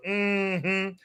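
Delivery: hummed mock affirmation; Kamala. Toy doll version of a politician, hummed mock affirmation.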